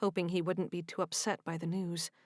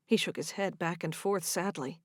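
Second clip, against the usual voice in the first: ordinary delivery, nothing marked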